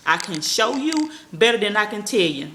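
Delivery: slow and deliberate. Shown as a demonstration. Repeating her motto slowly so viewers catch it. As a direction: spell it out slowly for them. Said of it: "I had to repeat myself".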